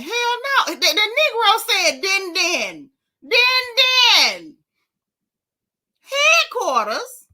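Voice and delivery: high, loud, incredulous outburst; outraged exclamation. Real, not acted. Vlogger reacting to the nickname Din Din, outraged exclamation.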